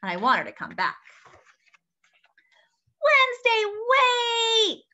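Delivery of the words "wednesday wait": calling out. Real, not acted. Calling after the running dog.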